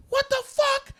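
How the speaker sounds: very high, loud screeching mom impression; mock outraged mom. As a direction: yell like a mom confronting an intruder. Mom finds stranger in her house.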